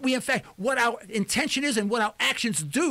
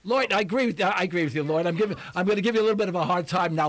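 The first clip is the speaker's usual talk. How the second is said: ordinary delivery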